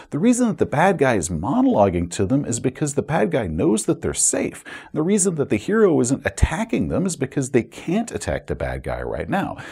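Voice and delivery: usual speaking voice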